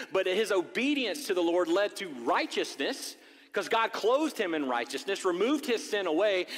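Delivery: preacher rising to full force; fervent preaching. Acted. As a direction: fire up the congregation. Preaching builds in intensity.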